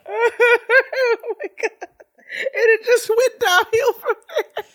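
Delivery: high, slow words through real laughter; laughing. Real, not acted. Can't stop laughing at clip.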